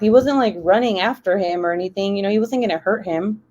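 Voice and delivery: ordinary talk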